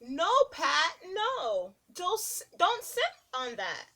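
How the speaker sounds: ordinary talk, nothing marked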